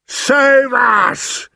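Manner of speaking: very high, loud giant's scream; terrified scream. A narrator's reading. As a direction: scream for help in nightmare panic. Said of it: "screamed the flesh lump eater".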